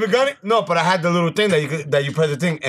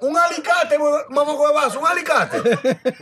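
exasperated shout; 10 semitones higher than usual. Retold or imitated. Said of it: "I'm like".

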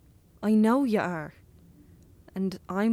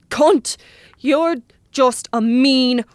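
louder, higher, slow spat insults; furious outburst; 3 semitones higher, 7 dB louder, much slower than usual. Acted. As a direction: hurl each insult at him. Boy hurls insults, furious.

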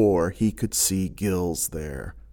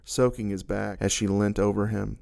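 usual speaking voice

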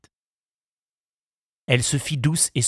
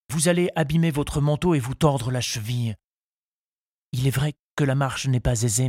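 higher voice of a shy suitor; solicitous young suitor; 5 semitones higher than usual. A narrator's reading. Charles worries about Henriette's ankle, shyly solicitous. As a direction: fuss over her, voice pitched up.

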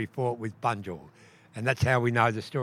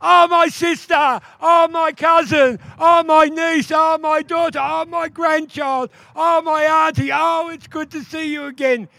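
joyful greeting cries; 14 semitones higher, 8 dB louder than usual. Acted. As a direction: cry out each relative with delight. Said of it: "I do it in a more modern way".

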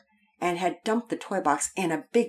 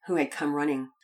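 usual speaking voice

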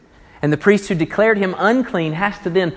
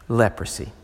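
lower, softer grave statement; solemn hush; 7 semitones lower, 5 dB quieter than usual. Real, not acted. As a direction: let the weight sink in. Solemn emphasis.

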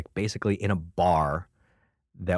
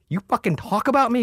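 much higher, outraged squeak; mock indignant; 4 dB louder than usual. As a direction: act flattered and offended. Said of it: "I'm like".

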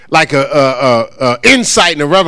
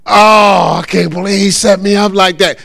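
mock indignation; 5 semitones higher, 4 dB louder than usual. Imitating a listener who feels set up, mock indignant. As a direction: complain as if caught out.

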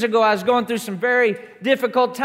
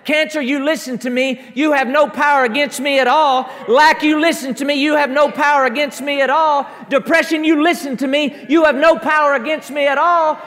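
loud rising commands, louder each time; commanding rebuke; 4 semitones higher, 4 dB louder than usual. Acted. Preacher rebuking cancer and lack, loud commanding. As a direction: order the troubles away at full voice.

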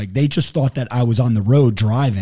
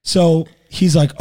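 ordinary talk, nothing marked

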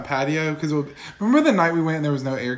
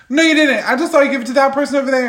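higher, louder mock-indignant retort; retold indignant protest; 7 semitones higher, 3 dB louder than usual. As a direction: argue back at the bartender, pitched up. Retold argument with bartender.

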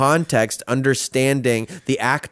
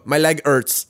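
put-on lightly done French Canadian accent; mock French Canadian complaint; 3 semitones higher, slower than usual. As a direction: imitate a hurt fighter with a French Canadian accent. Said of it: "In his famous French Canadian accent".

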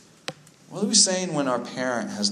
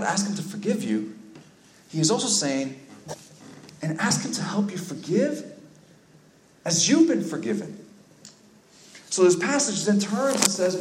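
high, urgent preaching that grows louder; impassioned preaching; 9 semitones higher, 5 dB louder than usual. Real, not acted. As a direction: drive the sermon up toward a climax.

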